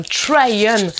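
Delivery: drawn-out English word in a French accent; bad English accent. Real, not acted. Announcing the video title to viewers, bad English accent. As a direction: stretch out the English with a poor accent. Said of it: "quel accent pourri".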